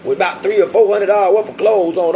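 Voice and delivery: talking trash. Retold or imitated. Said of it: "I'm talking shit".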